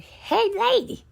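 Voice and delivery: high, loud calling out for the lady; imitating a holler. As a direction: call across the house in a high hollering voice. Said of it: "started hollering".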